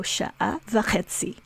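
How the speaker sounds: slow sha'a v'chetzi, stressed on chetzi; stress point. Shown as a demonstration. Teacher repeating the stressed example for students. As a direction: slowly mark the stress on chetzi. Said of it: "we call that a stress point".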